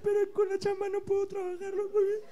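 high, whiny fake crying; mock sobbing apology. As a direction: blubber an apology.

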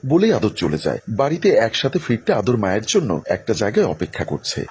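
ordinary delivery

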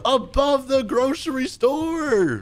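high, stretched-out wailing yell; hammed-up high-pitched outrage; exasperated shout. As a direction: rant at the driver in mock despair. Scolding the driver who missed it.

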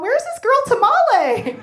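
high, loud, slow puzzled call; searching. Retold or imitated. Searching the neighborhood for a girl, high call. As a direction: call out looking around. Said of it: "I'm all".

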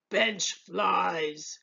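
odd creature voice; monster voice. A narrator's reading. Hungry thing ordering from the head waiter, creature voice. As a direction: grumble out the order. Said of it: "said the hungry thing".

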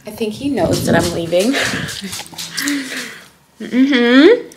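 talking while chewing gum; words round a piece of gum. Real, not acted. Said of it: "Sorry for the gum chewing".

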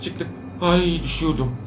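normal talking voice

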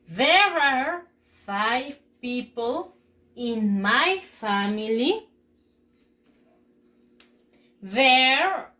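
very slow, loud, deliberate English reading; repeat-after-me pronunciation model. Shown as a demonstration. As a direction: stretch each phrase and pause for the class to repeat. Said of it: "Repeat after me". (modelo de pronunciación lento para repetir)

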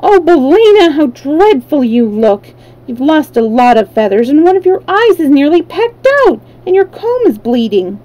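high burst of concern at the start; lightly acted alarm, swooping up in pitch; dismayed exclamation. A narrator's reading. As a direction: exclaim in dismay over a hurt friend. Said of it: "lifted her friend in her arms and exclaimed".

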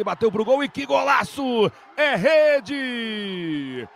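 loud high goal shout; explosive goal commentary. Real, not acted. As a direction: erupt on the golaço. Fourth goal, golaço. (grito de gol explosivo)

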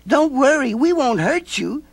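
put-on high little girl, reassuring; calm the creature, high and sweet. A narrator's reading. Nancy soothing the scared mope, high girl voice.

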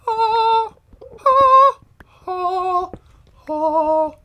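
loud high ah cries in a row; high mock yelps. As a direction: yelp each ah high and loud. Digging, mock sing-song cries.

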